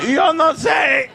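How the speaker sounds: 笑着拖长的假西班牙语; slow, loud, laughing mock Spanish shrug. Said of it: "And I was like".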